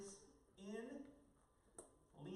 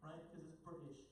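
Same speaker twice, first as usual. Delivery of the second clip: ordinary talk, nothing marked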